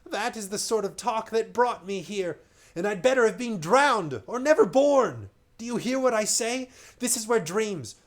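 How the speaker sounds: high, loud frantic rant; the stranger raging at the sailors, loud frantic warning. Acted. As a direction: rage and plead with the crew. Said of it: "stamping his foot with rage".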